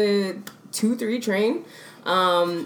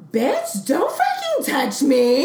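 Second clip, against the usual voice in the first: higher, drawn-out affronted gentrifier; 5 semitones higher, much slower than usual. Retold or imitated. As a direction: imitate an outraged gentrifier, stretching the words. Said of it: "like, the gentrifier voice".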